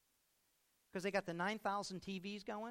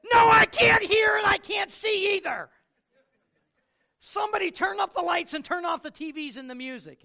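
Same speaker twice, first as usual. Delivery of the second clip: much higher and louder shout; exasperated shout; 13 semitones higher, 19 dB louder than usual. Retold or imitated. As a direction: yell it over the noise and dark. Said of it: "you just wanna say".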